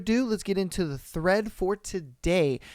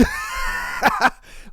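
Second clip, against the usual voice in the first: shrieking laugh. Real, not acted. Laughing at own outburst.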